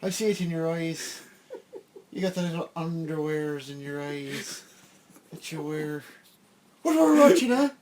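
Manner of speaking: gruff threatening Irish cop voice; angry cop. Acted. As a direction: menace them as the beat cop. Cop character threatens the hosts.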